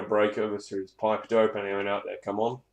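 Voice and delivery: usual speaking voice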